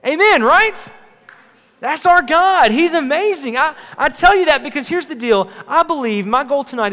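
loud, high, energized; fired-up preaching. Real, not acted. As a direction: keep the crowd energy up. Fired up after shout.

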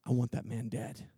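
low, soft menacing growl; menacing Pharaoh. Acted. Pharaoh ordering Moses killed, low menace. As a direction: drop low and threaten quietly. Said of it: "Pharaoh goes nuts".

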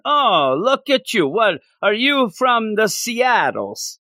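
high, slow, hammed-up Canadian accent; cartoon Canadian, drawn out; mock Canadian accent. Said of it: "That's how I think everybody in Canada talks".

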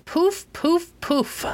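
high snorting puffs as he surfaces from the water. A narrator's reading. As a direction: blow the water out in sharp snorts. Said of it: "snorted King Midas".

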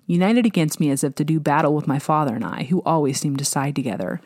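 ordinary delivery

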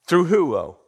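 loud call to the room; preacher prompting the crowd. Real, not acted. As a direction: call it out to the church.

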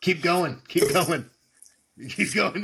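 loud hyped-up cheering; frat bro. Real, not acted. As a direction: pump him up like a party chant. Cheering him on to chug.

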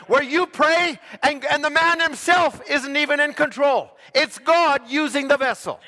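loud, high fiery preaching; preaching at full force. Acted. Pastor urging the flock to keep a home base, fiery preaching. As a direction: drive it home like a revival preacher.

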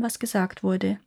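ordinary delivery, nothing marked

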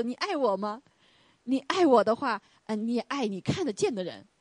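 voice of Jesus. Retold or imitated. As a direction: press the question gently but firmly. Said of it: "主耶稣：".

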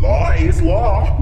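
loud slow high taunt; slow loud mocking repeat. Trickster taunts the kids.

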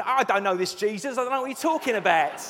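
mock Cockney accent. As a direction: deny it all in broad Cockney. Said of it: "It kinda goes all Cockney".

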